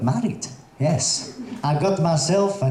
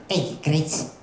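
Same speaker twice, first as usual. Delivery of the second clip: put-on Scouse accent; 3 dB quieter than usual. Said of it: "the accent there is like".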